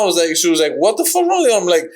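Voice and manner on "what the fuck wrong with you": much higher-pitched mock disbelief; imitated incredulous demand. Girlfriend to man after his collapse, imitated incredulity. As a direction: retell her baffled outburst, pitched way up.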